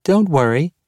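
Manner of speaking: put-on small girl's voice, pitched well up; tiny child voice. A narrator's reading. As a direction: sing it out like a tiny eerie child. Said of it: "in her tiny voice".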